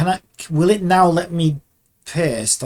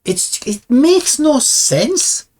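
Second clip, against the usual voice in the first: drawn-out high, loud protest; mad, exasperated; 10 semitones higher, 5 dB louder, much slower than usual. Real, not acted. Animator baffled by the software's design, mad. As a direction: stretch it out in angry disbelief. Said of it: "I'm so fucking mad right now".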